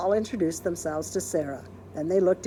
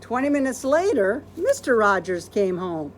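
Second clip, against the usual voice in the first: plain reading voice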